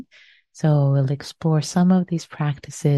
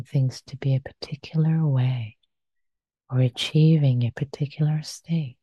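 guided meditation. Acted. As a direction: guide them gently into stillness.

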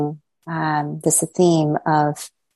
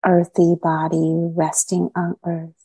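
unhurried meditation guide, long silences between phrases; guided meditation. Acted. Teacher easing meditators gradually into body, mind and heart, guided meditation. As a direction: guide them into settling, phrase by phrase.

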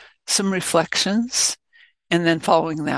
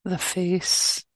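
calm, unhurried meditation guidance; short, even phrases with long silences between; guided meditation. Real, not acted.

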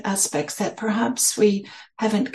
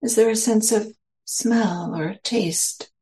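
slow, spacious meditation guidance with long pauses; guided meditation. Real, not acted. Teacher settling listeners into their senses, slow guided meditation. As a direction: lead them gently inward, phrase by unhurried phrase.

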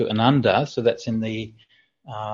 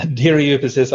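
usual speaking voice

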